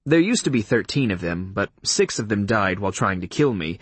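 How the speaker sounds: ordinary delivery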